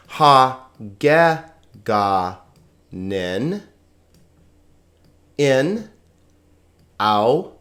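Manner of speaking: very slow, each syllable set apart by long pauses; slow, separated teaching pronunciation. Shown as a demonstration. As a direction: model each sound for beginners, one at a time. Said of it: "on to the pronunciation".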